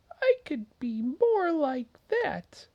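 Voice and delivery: high wistful little mouse; small mouse voice. Acted. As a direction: sigh it like a tiny dreamer.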